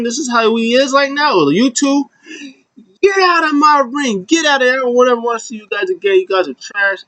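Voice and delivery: high-pitched imitation of a wrestler's tirade; mock outrage. Wrestler ordering two partners out of his ring, mock outraged. Acted quarrel, throwing people out of ring.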